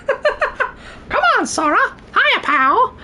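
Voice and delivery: very high cartoon voice; put-on character voice. Shown as a demonstration. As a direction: ask it in a squeaky cartoon falsetto. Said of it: "As for other voices".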